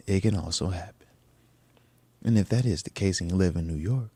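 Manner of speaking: hushed, low ad disclaimer; ad read. Pretend. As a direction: trail down into near-whispered fine print.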